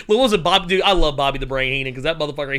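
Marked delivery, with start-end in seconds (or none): none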